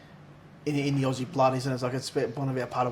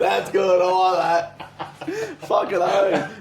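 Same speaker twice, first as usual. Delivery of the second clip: laughing; 5 semitones higher, 6 dB louder, much slower than usual. Real, not acted. Laughing after joke.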